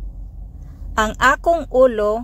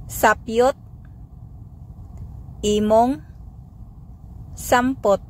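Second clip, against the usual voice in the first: Sapyot, imong, sampot said one by one with pauses; word by word; 4 dB quieter than usual. Shown as a demonstration. Teacher drilling the phrase for learners, word by word. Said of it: "Sample phrases".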